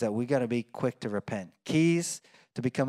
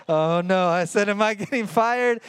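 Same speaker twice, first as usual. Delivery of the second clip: loud, high-pitched mock alarm; retold dismay, rising to a high, loud question; acted panic; 8 semitones higher, 9 dB louder than usual. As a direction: blurt it out as the worried employee. Retold panic at maybe being fired.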